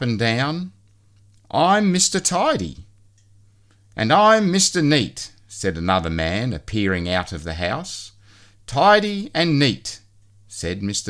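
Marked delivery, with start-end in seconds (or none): 1.5-2.8 high, prim little man; chirpy neat-freak introducing himself. A narrator's reading. Mister Tidy greets Mister Messy, high and prim. Character voice, Mr Men story. 4.0-5.3 high, prim little man. A narrator's reading. Mister Neat introduces himself to Messy, high and perky. Character voice, Mr Men story. 8.7-10.0 high, slow, prim sing-song. A narrator's reading. Mister Tidy recites his motto, high and slow.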